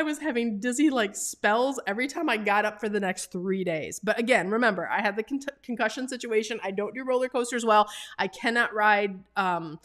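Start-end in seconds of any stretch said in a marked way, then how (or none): none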